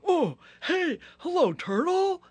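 high, loud, cheery rhino; friendly Rhino. Acted. Rhino waking and greeting Turtle, loud and cheery. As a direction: greet her like a big jolly rhino.

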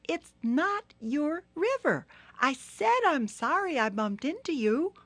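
high, firm turtle protest; insist, a bit indignant, high; high Turtle, getting cross. Acted. Turtle standing up to Hippo, high and firm.

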